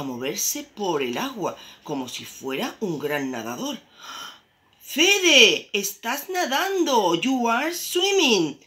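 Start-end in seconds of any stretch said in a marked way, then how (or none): 4.8-8.6 high, loud delighted exclamation; proud, thrilled storybook father; amazed dad. A narrator's reading. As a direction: cry out in astonishment at the little one. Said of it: "said Daddy".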